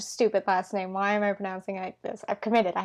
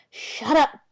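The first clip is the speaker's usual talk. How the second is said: hissed; 5 semitones higher, 4 dB louder than usual. A narrator's reading. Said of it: "Nancy hissed".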